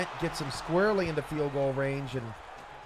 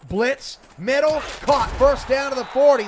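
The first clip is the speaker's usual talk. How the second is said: louder, higher, rising call of the catch; excited play-by-play; 8 semitones higher, 11 dB louder than usual. Real, not acted. Announcer calls a first down for listeners, excited. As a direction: call the big play with a jump in energy.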